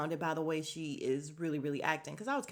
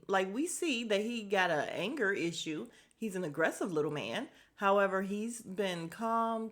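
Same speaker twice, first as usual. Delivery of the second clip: usual speaking voice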